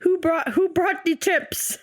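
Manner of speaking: high, slow silly teasing voice. Acted. Teasing in a put-on voice.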